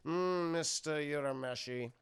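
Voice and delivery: slow, drawn-out sinister teacher; measured, disdainful villain greeting; Snape. Retold or imitated. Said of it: "The guy looks like Snape".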